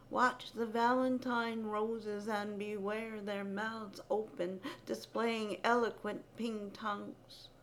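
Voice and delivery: ordinary delivery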